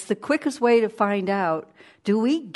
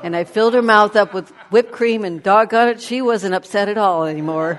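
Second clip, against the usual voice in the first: louder, proud punchline; loud triumphant telling; 8 dB louder than usual. Real, not acted. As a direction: land the payoff with a big voice.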